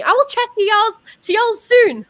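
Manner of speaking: high, loud mock-Southern sign-off; playfully put-on Southern goodbye; playful Southern y'all. Trying out borrowed y'all, playful sign-off.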